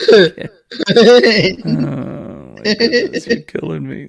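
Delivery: laughing so hard she's crying; breathless giggles through the words; laughing to tears. Real, not acted. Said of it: "See, I'm crying".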